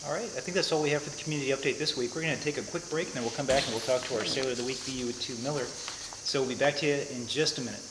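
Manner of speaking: ordinary talk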